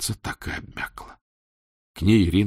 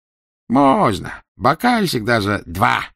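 slow, higher indulgent doctor; indulgent permission; 4 semitones higher, 5 dB louder, slower than usual. Acted. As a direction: grant it generously, drawn out. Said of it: "разрешил Казополу".

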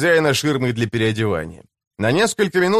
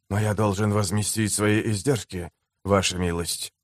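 low, soft protest; restrained slave trader; 6 semitones lower, 6 dB quieter than usual. A narrator's reading. Shkin objects to the mayor over his costs, low and restrained. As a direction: object quietly in a lower, controlled voice.

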